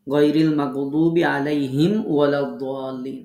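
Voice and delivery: slow, measured Quranic recitation. Shown as a demonstration. Teacher reciting an example verse to students, slow chant. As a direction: recite the verse slowly with clear tajweed. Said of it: "উদাহরণে দেখিয়ে দিলাম".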